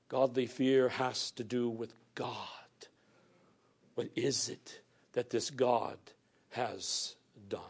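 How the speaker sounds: softly whispered aside to the congregation. Real, not acted. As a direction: breathe it as a hushed secret. Preacher drops to a whisper.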